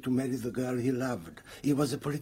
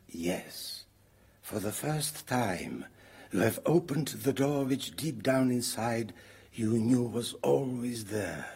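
ordinary delivery

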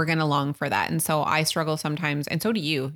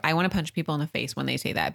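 ordinary talk, nothing marked

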